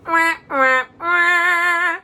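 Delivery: high loud sad-trombone wah; mock wah wah. Reader mocks the cliffhanger ending with a high loud wah wah.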